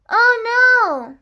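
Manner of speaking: high, loud dismayed cry. Acted. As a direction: sound truly alarmed. Reacts to the mishap.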